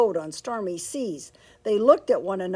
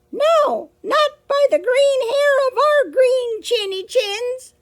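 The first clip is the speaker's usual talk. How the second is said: high piggy voice; 9 dB louder than usual. Acted. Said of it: "said the pigs".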